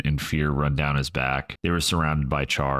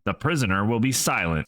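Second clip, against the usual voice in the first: snapped; 6 semitones higher than usual. A narrator's reading. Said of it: "snapped Crank".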